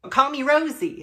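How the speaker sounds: high, loud bright purr; playful invitation to first names; tinkling laugh. Acted. Rosie inviting the narrator to use her nickname, tinkling. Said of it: "with a laugh that tinkled".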